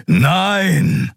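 usual speaking voice

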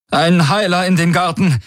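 high, urgent, trembling cry for help; alarmed, high-pitched order; trembling call. A narrator's reading. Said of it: "rief sie mit zitternder Stimme".

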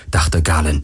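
ordinary delivery

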